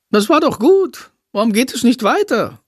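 why are we stopping; impatient bat comment. Acted. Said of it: "kommentiert Sándor".

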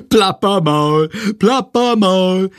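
slow, high sing-song taunt; giggly taunt. Acted. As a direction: tease through laughter. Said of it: "hält sich den Bauch vor Lachen".

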